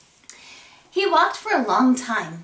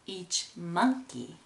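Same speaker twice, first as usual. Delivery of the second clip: ordinary delivery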